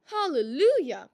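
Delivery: loud, high Hallelujah of delight; joyful cry. A narrator's reading. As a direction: shout it for joy. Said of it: "cried Malice".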